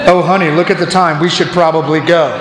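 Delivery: sheepish spectator. Retold or imitated. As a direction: slink off embarrassed, low and quick. Spectator backs out to spouse.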